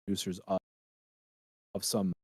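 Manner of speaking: audio cutting out, dropping away; mic breaking up mid-sentence; bluetooth dropout. Real, not acted. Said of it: "you're cutting out again".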